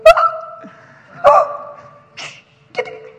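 high gasps, then a shush; mock scandalised gasps. Churchgoers react to a noisy child, mock gasp and shush. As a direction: mimic shocked people hushing a child.

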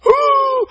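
high, loud mock wail; bawling wail. Retelling his reaction to being healed, bawling wail. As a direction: imitate an overwhelmed crying ooh. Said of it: "I'm bawling".

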